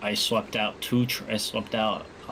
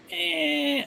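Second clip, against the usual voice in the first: high mock grunt; 5 dB louder than usual. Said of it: "I was like".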